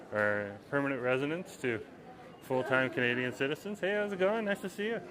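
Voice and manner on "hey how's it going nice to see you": ordinary delivery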